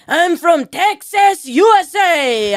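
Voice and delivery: hammed-up Texan boast, loud, high and drawn out; mock Texas accent. Imitating a TikTok guy telling an interviewer his origin, mock Texas accent. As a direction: slowly holler it like an exaggerated Texan. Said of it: "Why is he talking like that?".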